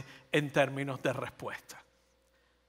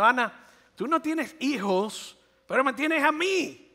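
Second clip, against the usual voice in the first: doting husband; 7 semitones higher than usual. Acted. Husband consoling Ana, high and smugly tender. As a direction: coo it like a husband proud of himself. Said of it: "le estaba diciendo".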